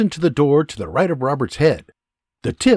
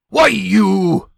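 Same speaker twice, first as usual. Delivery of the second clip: growl; 6 semitones higher, 6 dB louder than usual. Acted. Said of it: "the angry soldier growls".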